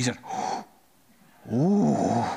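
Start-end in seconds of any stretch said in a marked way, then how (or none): 1.5-2.2 the employee realising who he is; imitated gasp. Employee gasps on hearing name.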